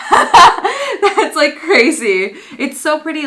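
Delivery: loud, high laughing amazement; delighted laughing. Real, not acted. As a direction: laugh through the words in disbelief. Overjoyed laughing at the gift.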